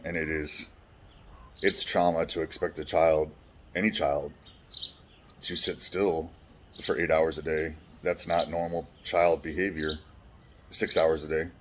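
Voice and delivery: usual speaking voice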